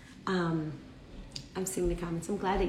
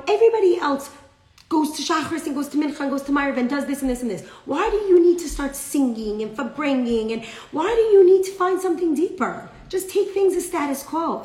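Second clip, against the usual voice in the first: high, loud exasperated nagging; scolding lecture; 6 semitones higher, 6 dB louder than usual. Acted. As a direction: reel off the reproach, punching each why. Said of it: "He's giving him musar".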